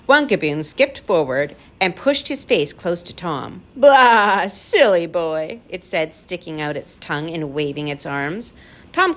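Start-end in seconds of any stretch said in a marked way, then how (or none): 3.8-5.6 loud blah, then drawn-out teasing; a put-on creature's jeer; taunting creature. A narrator's reading. Gaboon pushing its face close to Tom, taunting.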